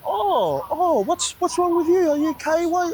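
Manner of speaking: high-pitched fussing nurse voice; put-on high voice of mock concern; imitated nurse. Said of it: "The nurse comes in like".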